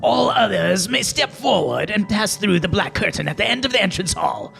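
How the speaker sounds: lightly voiced raspy hag door; a touch of a rasping old crone. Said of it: "in a raspy voice".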